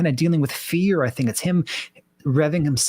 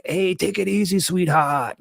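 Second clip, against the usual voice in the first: higher-pitched, hammed-up New York accent; mock East Coast New York accent; 4 semitones higher, slower than usual. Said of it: "doing an East Coast New York".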